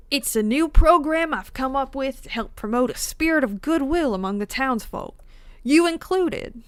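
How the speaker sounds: attempted Southern accent. Streamer reading the townsman's lines in a would-be Southern accent. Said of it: "This is not a Southern accent".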